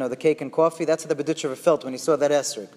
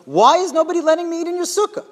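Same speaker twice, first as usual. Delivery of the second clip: high, loud retold outburst; storyteller acting out an exasperated shout; exasperated yelling; 11 semitones higher, 5 dB louder than usual. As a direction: yell the question like someone rejected everywhere.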